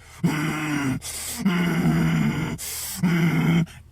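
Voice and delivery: long, loud, high groans of pain; imitated agonized moaning; acted pained groaning. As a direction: groan through the pain in drawn-out waves. Imitating himself hunched over in pain.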